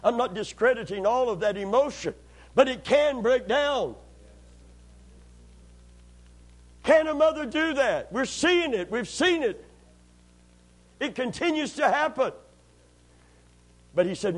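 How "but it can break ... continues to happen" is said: higher, stretched preacher's cry; heated sermon climb; rising preaching. Acted.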